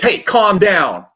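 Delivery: loud, slow, sharp "Hey, calm down". Shown as a demonstration. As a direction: snap it at them, loudly and slowly. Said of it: "But none of this".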